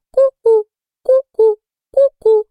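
loud, very high cuckoo; shrill mechanical cuckoo calls; cuckoo clock call. A narrator's reading. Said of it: "из настенных часов выскочила кукушка".

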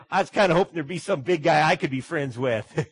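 real chuckle while admitting it; amused, laughing confession. Real, not acted. Laughs at his own joke.

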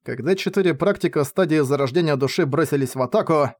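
ordinary delivery

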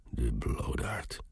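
low, soft, slow half-whisper; muttering. A narrator's reading. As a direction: mutter it to yourself under your breath. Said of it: "mompelde hij". (zacht en laag mompelend)

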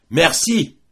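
loud, high triumphant cry; shouted thanks. A narrator's reading. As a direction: call it out across the gorge. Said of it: "cria Roland". (merci crié, fort et aigu)

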